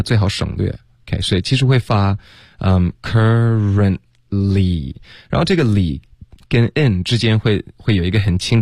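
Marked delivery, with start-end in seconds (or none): 3.0-4.9 "currently" slowly as cur-ren-lee, no t; dropped t. Shown as a demonstration. Teacher shows how it is really said, dropped t in currently. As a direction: draw out each syllable of "currently", leaving out the t. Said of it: "currently的这个t呢，最好省略".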